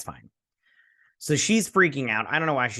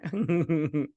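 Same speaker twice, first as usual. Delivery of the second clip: pleased giggle after a quip; short self-amused laugh; chuckle at own joke; 3 semitones higher than usual. Real, not acted.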